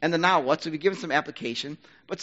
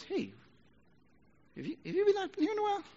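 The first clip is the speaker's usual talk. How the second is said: much higher, sing-song fake surprise at the returner; pointed mock greeting; faster than usual. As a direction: needle her with a sweet, too-high "have you been". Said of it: "Wouldn't that be a little offensive?".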